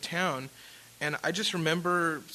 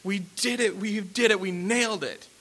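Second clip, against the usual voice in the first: high, imitated weepy triumph; mock tearful joy; 5 semitones higher, 4 dB louder than usual. Manager's assistant after the showcase, mock tearful joy. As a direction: imitate someone crying with relief, pitch raised. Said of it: "was crying because he was like".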